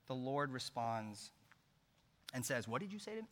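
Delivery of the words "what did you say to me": mock threat. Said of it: "No, he doesn't say that".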